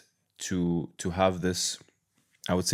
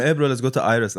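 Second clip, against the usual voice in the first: put-on Lebanese accent; 6 semitones higher, 7 dB louder than usual. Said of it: "No, I'm joking".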